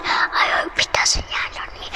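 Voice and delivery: soft unvoiced whisper; whispered aside. Storyteller's whispered aside about the falling ball. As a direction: whisper it to yourself.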